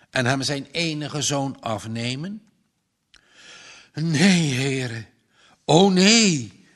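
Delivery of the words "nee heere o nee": loud anguished groan of prayer. Acted. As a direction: groan it out to God in despair. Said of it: "kreunde de boer".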